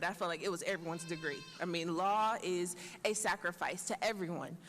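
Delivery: ordinary talk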